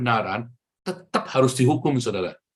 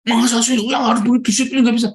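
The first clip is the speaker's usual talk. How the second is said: loud, high-pitched outburst; acted heated objection; indignant protest; 10 semitones higher, 8 dB louder than usual. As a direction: protest loudly as an angry father. Acts out the angry father's protest.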